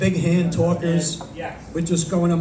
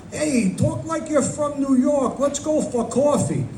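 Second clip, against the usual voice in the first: higher, drawn-out exaggerated New York accent; mock New York accent; 7 semitones higher, 4 dB quieter, slower than usual. Stranger in Publix teasing the comic's speech, mock New York accent. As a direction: imitate a New York accent, pitched up and slow. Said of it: "very heavy New York Italian accent".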